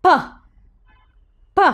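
"p" as a very short "puh" burst; phonics sound. Shown as a demonstration. Teacher sounding out the letter, short isolated "p" pops. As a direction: pop each "p" alone, with a long gap. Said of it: "P says".

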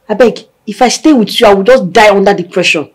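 normal talking voice

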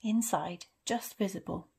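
ordinary delivery, nothing marked

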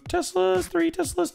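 high, very slow sing-song squeak on "two Teslas"; silly high voice. Pretend.

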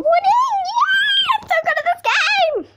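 high laughing cheer; excited shout. Acted. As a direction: squeal with delight at winning. Celebrating game level win.

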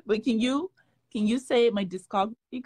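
ordinary talk

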